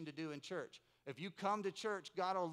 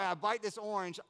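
normal talking voice

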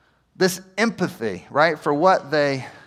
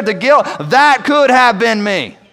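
loud proclamation; 3 semitones higher, 6 dB louder than usual. Acted. Loud repeat of earlier quote.

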